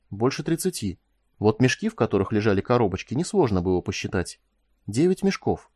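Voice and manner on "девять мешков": usual speaking voice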